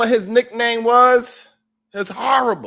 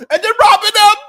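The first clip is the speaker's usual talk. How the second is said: much higher, louder indignant outburst; mock outraged shout; 6 dB louder than usual. Viewer ranting that the moderator timed him out, mock outraged shout. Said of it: "They will literally email me".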